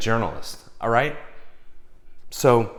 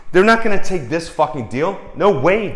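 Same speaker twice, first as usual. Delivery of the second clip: ordinary delivery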